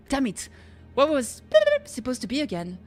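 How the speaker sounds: ordinary delivery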